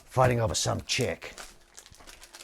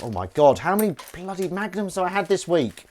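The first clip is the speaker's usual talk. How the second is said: mock horror; 7 semitones higher, 10 dB louder than usual. Real, not acted. Shocked at own greed.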